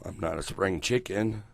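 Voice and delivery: ordinary talk, nothing marked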